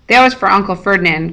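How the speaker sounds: ordinary delivery, nothing marked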